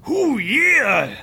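whooping cheer, high; bandits cheering. Acted. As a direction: cheer like a wrestler. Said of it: "The bandits cheered".

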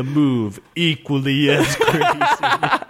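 slow, laughing punchline. Real, not acted.

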